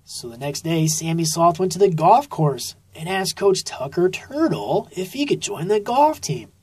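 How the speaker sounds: usual speaking voice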